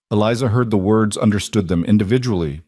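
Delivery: usual speaking voice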